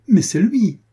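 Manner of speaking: high, loud burst of recognition; excited cry. Acted. Young lady spots her friend riding up, crying out in delight. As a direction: cry out with sudden joy. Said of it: "s'écria mademoiselle de Souvigny".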